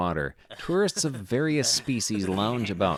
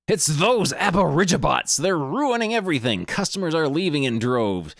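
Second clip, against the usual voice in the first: higher, louder, worked-up host complaining; excited outburst; 6 semitones higher, 3 dB louder than usual. Acted. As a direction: blurt it out like it's all ruining him.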